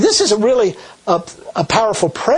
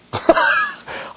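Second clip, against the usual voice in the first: high unvoiced laugh at the irony; a quick airy chuckle; breathless laugh; 3 dB quieter than usual. Real, not acted. Laughs at wrath line.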